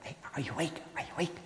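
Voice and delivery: dog softly nudging its sleepy owner awake; quiet nudging dog. Retold or imitated. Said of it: "I hear Rexy going".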